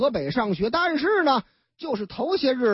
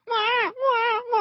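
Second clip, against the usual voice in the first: mock baby wail; 12 semitones higher than usual. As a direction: wail like a newborn. Newborn baby crying.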